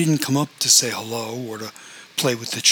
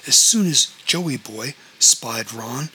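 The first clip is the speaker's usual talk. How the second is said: plain reading voice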